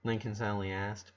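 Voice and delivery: ordinary delivery, nothing marked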